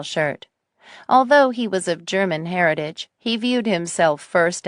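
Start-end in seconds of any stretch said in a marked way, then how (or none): none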